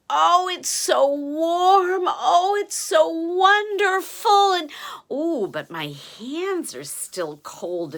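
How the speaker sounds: high, loud, slow sighing delight; cozy delight. A narrator's reading. Giant Og snuggling into the coat, slow and high. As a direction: savour the warmth, drawn out.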